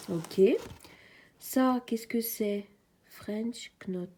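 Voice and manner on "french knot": whispered English term in a French accent; English pronunciation. Real, not acted. Apologising for her English, "French knot" whispered in a French accent. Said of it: "Pardon pour mon anglais".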